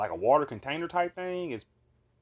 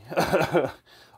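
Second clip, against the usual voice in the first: loud, higher burst of laughter; amused laugh; 5 semitones higher, 9 dB louder than usual. Real, not acted. Storyteller cracks up at zombie officers, amused laugh. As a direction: crack up at your own line.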